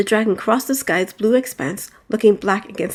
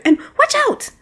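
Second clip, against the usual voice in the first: high sudden warning yell; warning cry; 3 dB louder than usual. Acted. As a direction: shriek a warning. Maria sees the claw.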